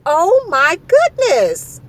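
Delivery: slow, drawn-out exasperation; exasperated. Retold or imitated. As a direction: stretch it out, fed up. Clerk ignores her again.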